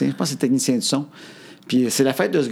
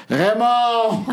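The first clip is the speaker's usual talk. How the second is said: crié haut, sonore; cri imité; 9 semitones higher than usual. Retold or imitated. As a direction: lance le nom comme un appel à la foule. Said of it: "mais il crie comme". (imite un technicien qui crie son nom en roulant les yeux, cri moqueur)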